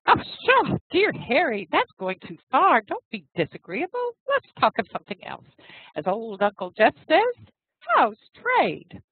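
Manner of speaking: arch and playful. Acted. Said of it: "she looked archly in his face".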